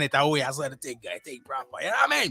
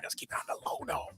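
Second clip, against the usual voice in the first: whispered, secretive aside; hushed whisper; 9 dB quieter than usual. Pretend. Radio host hushing listeners about the t-shirts, whispered. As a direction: drop to a whisper like sharing a secret.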